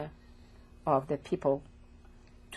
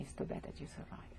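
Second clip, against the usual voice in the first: whispered, almost breathless, the cruel words; hushed whisper; 15 dB quieter than usual. Retold or imitated. Survivor retelling a soldier's cruel words, whispered. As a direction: let it drop to a bare whisper.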